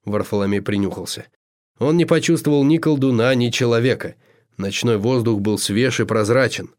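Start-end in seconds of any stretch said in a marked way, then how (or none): none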